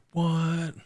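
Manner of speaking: sudden high shocked what; a stunned what at a plot twist. Retold or imitated. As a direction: blurt it out in disbelief. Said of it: "I'm like".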